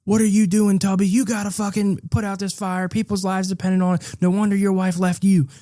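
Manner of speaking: high-pitched drill sergeant tirade. Acted. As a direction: berate the recruit without a breath. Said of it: "like a full-blown drill sergeant".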